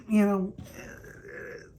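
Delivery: ordinary talk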